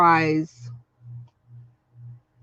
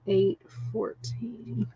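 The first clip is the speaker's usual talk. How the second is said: softer, slow murmured repeat of Eight fourteen; 9 dB quieter, slower than usual. Real, not acted. Rereading a viewer's guess to herself, soft and slow. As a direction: murmur the number back to herself.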